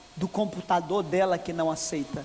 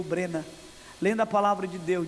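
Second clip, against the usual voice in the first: usual speaking voice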